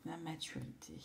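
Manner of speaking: whispers the word to herself; whispered. Real, not acted. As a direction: softly breathe it like a secret. (chuchoté pour soi-même)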